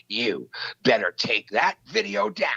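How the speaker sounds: normal talking voice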